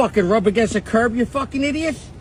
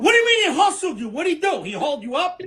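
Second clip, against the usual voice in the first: brash Italian-American guy bickering in a sketch; hammed-up Italian-American accent, swearing and mocking. Acted sketch with Italian voices.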